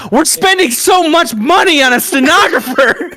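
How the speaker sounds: high squeaky laughing whine; laughing quote. Acted. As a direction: say it while dissolving into laughs. Mock clip of themselves.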